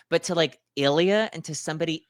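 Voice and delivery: ordinary delivery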